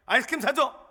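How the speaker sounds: put-on high, loud demand of a small boy; confident child demand. Little son demanding ice cream from his father, high bold demand. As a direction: ask for ice cream like it's owed. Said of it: "너무 당당해".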